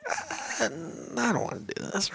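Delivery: high, soft comic whimper; mock reluctance. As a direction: shrink back in a small, high voice. Reluctant thought at screaming child's door.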